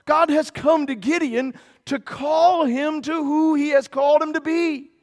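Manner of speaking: God's reassurance preached loudly; preacher voicing God to the frightened Gideon; full-force preaching. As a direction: preach the promise, building up.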